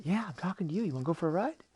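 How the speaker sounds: high, bouncy dolphin voice; insistent cartoon dolphin; talking dolphin. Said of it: "And the dolphin said".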